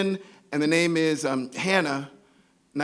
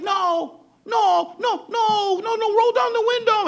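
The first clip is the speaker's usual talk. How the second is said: very high, urgent squealed pleading; escalating mock-panic screech; imitated panicked shriek; 4 dB louder, slower than usual. Friend panics at imminent vomiting.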